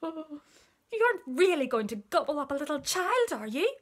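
high, alarmed pleading; frightened monkey. A narrator's reading. Pale monkey questions crocodile, high and frightened. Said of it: "went pale and began to shake all over".

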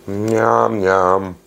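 eating between words; talking while chewing. Real, not acted. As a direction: mouth partly full of food. Seen 4: Mouth works on food held at lips while saying yum-yum.

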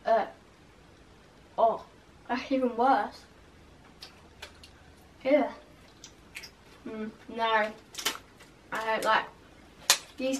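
grimacing at taste; chewing while talking. Real, not acted. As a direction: mouth partly full. Seen 0: grimacing, mouth working on candy while holding packet, talking.

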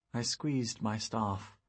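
ordinary delivery

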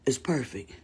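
a pleased little whisper; hushed satisfied whisper. Real, not acted. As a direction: breathe it out, no voice. Admiring her fixed lashes.

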